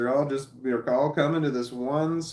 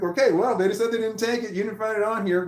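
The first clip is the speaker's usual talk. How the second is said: dismissive wrap-up; 10 semitones higher, 4 dB louder than usual. Retold or imitated. Grandpa closing the matter.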